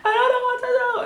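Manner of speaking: very high hysterical wail, acted out; mock-hysterical high-pitched cry; freaking out. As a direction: re-enact total panic, squealing. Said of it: "I'm freaking out so much".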